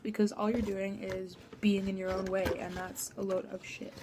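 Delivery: normal talking voice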